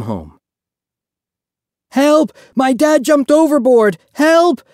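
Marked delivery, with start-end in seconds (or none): 1.9-4.6 screamed high and loud, frantic; a boy yelling for rescue from the deck; desperate cry for help. A narrator's reading. Boy shouting to the crowd as his dad falls, screaming. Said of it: "Joseph cried".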